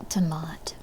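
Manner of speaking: whispered pet name; soft whispered cat name. Real, not acted. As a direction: breathe the name softly.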